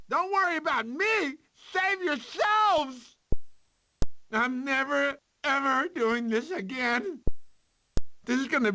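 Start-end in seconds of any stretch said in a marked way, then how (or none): 0.1-3.2 dramatic call. Acted. Sloth heroic panic.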